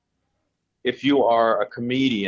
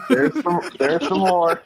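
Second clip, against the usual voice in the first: laughing; 9 semitones higher, 3 dB louder than usual. Real, not acted. Laughing at the cat noises.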